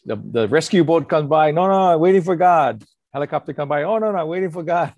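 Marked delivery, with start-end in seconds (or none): 1.5-2.8 a higher, louder, insistent refusal; stubborn man on roof. Retold or imitated. Man on flooded roof refusing boat. 3.8-4.9 higher, singsong refusal of the helicopter; stubborn man on roof. Retold or imitated. Man refusing helicopter, retold joke.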